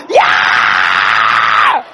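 loud triumphant yell; cheering shout. Retold or imitated. Preacher cheers the exploding plane, triumphant shout. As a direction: yell out in glee.

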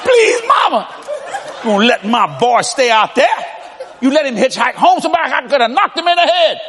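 put-on whiny kid begging his mama; begging son. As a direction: son pleads with mama for help. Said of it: "I says".

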